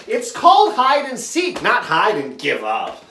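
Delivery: high, loud playground comeback; worked-up kid arguing the rules; mad kid. Retold or imitated. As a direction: protest like an indignant child, pitch falling at the end. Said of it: "he was all mad".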